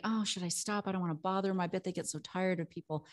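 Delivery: soft, as if thinking to herself; hesitant inner thought. Retold or imitated. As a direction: voice a private worry quietly. Voicing her own hesitant thoughts.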